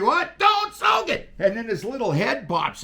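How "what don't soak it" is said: high, slow, shouted warning; frantic shout over the handset; retold yell. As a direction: yell it over the radio in a panic. Retold crash, yelling.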